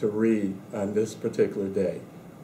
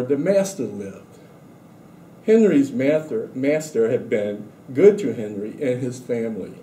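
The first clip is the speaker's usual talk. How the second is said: story reading; 3 semitones higher than usual. A narrator's reading. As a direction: read the picture book aloud to the class. Reads picture book to students.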